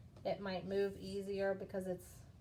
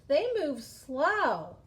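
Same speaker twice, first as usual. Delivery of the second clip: slow, loud, stretching out SLOW; drawn-out emphasis; 4 semitones higher, 6 dB louder, much slower than usual. Shown as a demonstration. Teacher telling pupils how caterpillars move, drawn out. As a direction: drag out "slow" like a crawling caterpillar.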